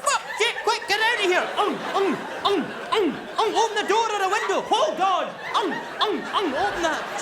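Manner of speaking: shrieking in a rush at the sick cat; panicked shriek. Pretend. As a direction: scramble to get the cat out.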